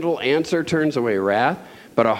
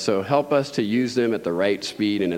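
prayer; 5 semitones lower than usual. Acted. Leads closing prayer.

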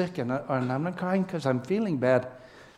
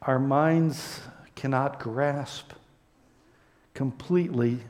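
closing prayer; 2 semitones lower than usual. Acted.

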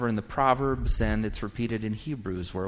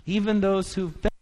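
impassioned preaching; 5 semitones higher, 4 dB louder than usual. Acted. Preaching climax on endurance.

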